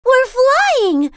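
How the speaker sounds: high, loud thrilled gasp; breathless delight as the boat takes off; gasping with wonder. A narrator's reading. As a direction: Grace gasps at flying over the sea. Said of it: "gasped Grace".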